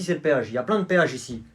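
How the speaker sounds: ordinary delivery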